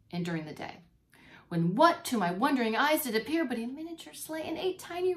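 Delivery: put-on lively read-aloud for children; reading the poem to the class, with expression; storybook reading. Teacher reading Christmas poem to kids, animated storytelling.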